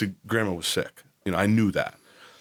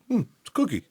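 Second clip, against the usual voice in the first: put-on high, innocent little kid answer; lightly voiced matter-of-fact toddler; high innocent child reply; 10 semitones higher, much faster than usual. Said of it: "He's like".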